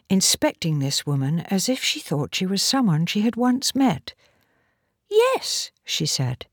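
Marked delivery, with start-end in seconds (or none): 5.1-5.7 put-on very high, small child voice; little girl. A narrator's reading. Young Polly answers the woman, little-girl voice.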